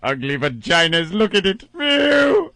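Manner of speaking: campy shrieking melodramatic ham; hammy stage actor. Pretend. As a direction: overact every line like a coward. Continuing Doctor Smith parody.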